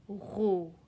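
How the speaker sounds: "ro" held as one syllable on its own; "ro" given as a model syllable; isolated syllable. Shown as a demonstration. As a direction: sound out "ro" by itself, evenly. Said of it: "c'est la syllabe".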